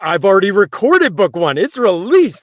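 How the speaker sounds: high, loud mock-panicked outburst; hammed-up incredulous protest; exasperated disbelief. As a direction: play up the narrator's dismay, voice shooting up. Acting out narrator's dismayed reaction.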